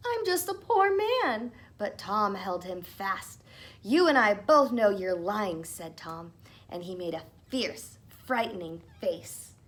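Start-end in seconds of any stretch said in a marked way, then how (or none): 0.1-1.5 high pleading whine of a tiny man; whining. Acted. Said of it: "twisted and whined". 3.8-5.6 stern threat. Acted. Tom threatening the leprechaun.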